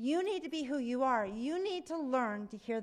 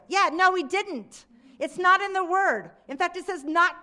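emphatic rebuttal; 6 semitones higher, 9 dB louder than usual. Real, not acted. Rebutting crazies' claim.

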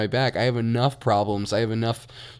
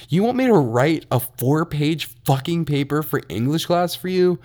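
louder, higher, incredulous mock outrage; retold angry outburst; 5 semitones higher, 3 dB louder than usual. Retelling his reply to the classmate, heated disbelief. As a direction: replay the fed-up retort with rising disbelief. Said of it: "I got so pissed".

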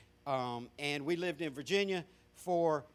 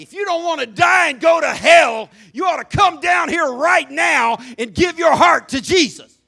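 booming retold preacher yelling the altar call; preacher's shout; 10 semitones higher, 17 dB louder than usual. Pastor hollering at the congregation, a loud shout. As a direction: belt it out like a revival preacher.

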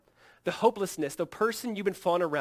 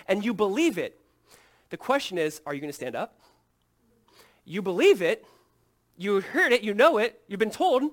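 loud, driving sermon voice; full-force preaching; 6 semitones higher, 10 dB louder than usual. Acted. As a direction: preach it out loud to the whole room. Preaching the foxhole illustration.

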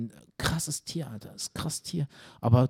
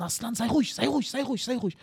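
high mock calls to hush the dog; family calling; 9 semitones higher than usual. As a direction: parents shouting at the barking dog. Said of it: "alle im Garten".

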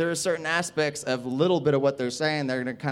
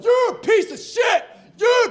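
very high, loud mock screech; furious kid imitation; 7 dB louder than usual. Angry kid stands up at the comedian, high screeched insult.

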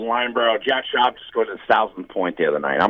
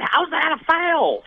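put-on high, drawn-out Indiana-accent heckle; Wade Graham; much slower than usual. Retelling a friend yelling at the refs, Indiana accent. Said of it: "yelling at the refs in an Indiana accent".

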